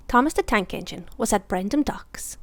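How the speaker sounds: plain reading voice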